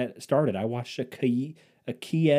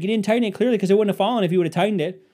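higher, louder, fast whiny wife; wife explaining the fallen curtain rod, higher accusing tone; mock wife blaming; 8 semitones higher, 7 dB louder, much faster than usual. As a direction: rattle off the blame in a higher voice. Wife accusing, acted quarrel.

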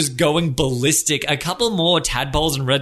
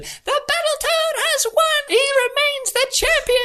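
tiny referee announcer. Acted. The tadpole referee on the mic naming the champion, squeaky. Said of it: "tadpole referee grabs it".

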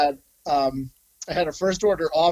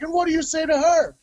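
much higher, pushy officer voice; demanding officer; 12 semitones higher, 3 dB louder than usual. Retold or imitated. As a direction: quiz him like a strict officer, higher. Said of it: "And he's like".

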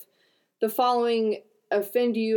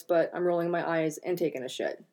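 ordinary talk

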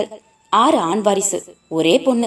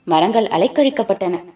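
ordinary delivery

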